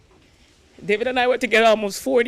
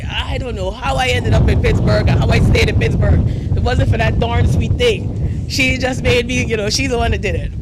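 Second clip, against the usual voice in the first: louder, playful mock of her husband's bragging; husband's boast; 6 dB louder than usual. Widow imitating her husband telling everybody, loud and playful. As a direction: boom it out like he did to everyone. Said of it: "he always used to tell everybody".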